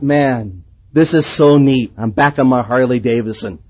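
low, laid-back musing; acted cool contentment. Man riding in back of truck, acted contented musing. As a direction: act out blissful daydreaming to yourself.

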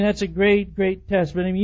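normal talking voice

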